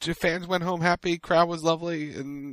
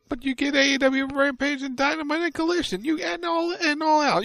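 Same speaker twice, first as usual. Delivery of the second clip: teasing; 10 semitones higher, 4 dB louder than usual. Real, not acted. Teasing Chicago fans.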